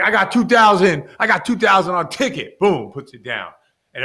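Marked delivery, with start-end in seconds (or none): 0.0-2.6 raised and louder, showing off the cash; higher and louder, mock-bragging bettor; loud eager betting call. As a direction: call out the bet like a big shot.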